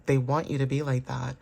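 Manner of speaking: ordinary delivery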